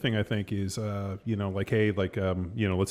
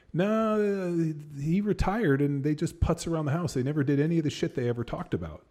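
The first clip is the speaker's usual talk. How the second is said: light higher retelling voice; voicing the storyteller; 7 semitones higher than usual. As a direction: lift the voice, gossiping.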